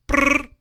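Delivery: high trilled "brrr"; imitated phone ring. Caller ringing the vets, trilled phone-ring noise.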